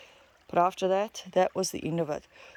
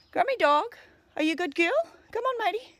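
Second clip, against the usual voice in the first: much higher sing-song pet talk; talking to a dog; 10 semitones higher than usual. Real, not acted. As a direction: coo at the dog, pitch way up. Talking to her dog on walk.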